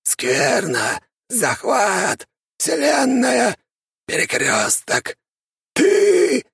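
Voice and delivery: high, slow, alien word fragments; eldritch horror. A narrator's reading. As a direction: intone each word like a cosmic being. Inhuman figure speaks in fragments.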